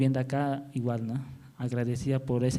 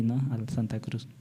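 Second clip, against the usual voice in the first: ordinary talk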